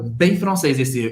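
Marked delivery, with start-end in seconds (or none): 1.1-1.1 "ê" very closed, lips rounded as for O; closed French vowel. Shown as a demonstration. Teacher models the French filler, very closed "ê". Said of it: "bem fechado".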